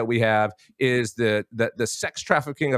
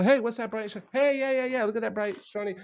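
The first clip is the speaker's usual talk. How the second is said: mock distracted gawker; 8 semitones higher, 4 dB quieter than usual. Mocking a distraction trick.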